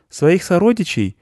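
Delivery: put-on high boy's question; high-pitched young boy asking gently; little boy. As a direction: ask in a high child voice.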